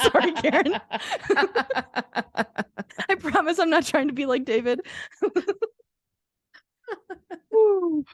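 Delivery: apologizing through bursts of laughter; laughing. Real, not acted. Apologizing to Karen for the monologue, laughing hard. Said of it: "I'm hanging on every word".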